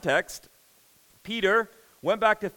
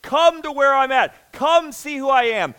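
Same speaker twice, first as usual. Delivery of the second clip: loud, higher calling out; urgent summons; 6 semitones higher, 9 dB louder than usual. Acted. Jesus inviting Peter to come, loud urgent call. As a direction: call them in with force. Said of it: "it's like".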